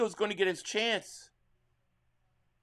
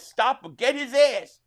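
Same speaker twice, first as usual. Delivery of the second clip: loud, higher fan yell; 6 semitones higher, 7 dB louder, slower than usual. Real, not acted. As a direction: shout at the defense. Yelling at the TV during play.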